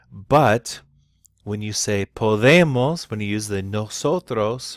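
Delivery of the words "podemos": "podemos" with a light "po" and a long, loud "de"; stress off the O. Shown as a demonstration. Teacher explaining the nosotros exception, stress on the "de" of "podemos". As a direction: punch the middle syllable of "podemos". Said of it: "you're not emphasizing that second to last syllable on the O".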